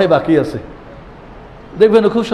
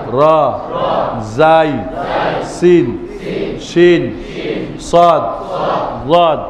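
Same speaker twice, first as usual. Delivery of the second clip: sustained letter names, spaced for repetition; letter drill recitation; much slower than usual. Shown as a demonstration. Teacher repeats the alphabet for the class, measured drill chant. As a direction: chant each letter singly and firmly. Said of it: "আপনাদেরকে আবার বলি".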